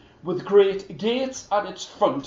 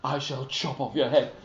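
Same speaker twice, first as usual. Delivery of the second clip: mock menace; 11 semitones lower, 10 dB quieter than usual. The king quietly threatens to behead Niels, low and hammy. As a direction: play a hammy villain.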